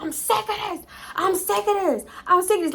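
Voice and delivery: high, repeated shout; imitated yelling. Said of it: "he's yelling".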